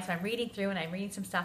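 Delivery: ordinary talk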